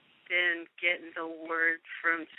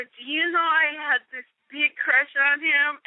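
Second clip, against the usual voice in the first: giddy confession; 7 semitones higher than usual. Retold or imitated. Retold confession of crush.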